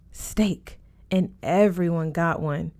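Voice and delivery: plain reading voice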